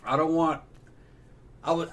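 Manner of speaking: normal talking voice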